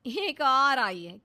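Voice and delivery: slow, loud sneering shout; daughter-in-law yelling at the newcomer, loud and slow. Acted. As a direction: bellow it out with contempt. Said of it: "चिल्ला चिल्ला कर बात करने लगती है".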